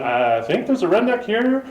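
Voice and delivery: high, loud software character piping up; voiced acoustic model. Acoustic model reporting to language model, high, loud voice. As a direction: pitch it up loudly as the guessing model. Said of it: "you have the acoustic model going".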